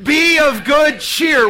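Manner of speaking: loud, beaming shout; with a big smile. Shown as a demonstration. Priest asking Catholics to smile, loud joyful shout. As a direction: shout it out smiling, slow. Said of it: "Say it again with a big smile".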